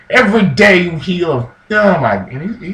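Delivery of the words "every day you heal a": louder, higher acted outburst; retold rant getting loud and high; noisy retort. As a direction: raise your voice in mock exasperation. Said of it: "he's getting a little noisy".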